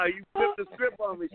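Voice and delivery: laughing. Real, not acted. Laughing as caller surprises host.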